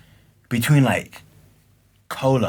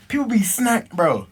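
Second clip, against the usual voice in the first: ordinary talk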